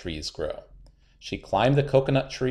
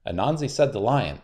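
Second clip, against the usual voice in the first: ordinary delivery, nothing marked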